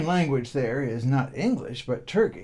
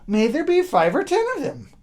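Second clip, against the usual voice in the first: put-on much higher, louder boyish chant; cheerful boy; 13 semitones higher, 6 dB louder than usual. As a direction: sing it out high and innocent. Boy at funeral.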